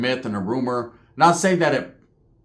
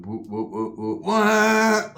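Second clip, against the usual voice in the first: imitated squeezing noises; 5 semitones higher, much slower than usual. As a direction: mimic muffled words ending in a squeal. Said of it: "He was just telling him".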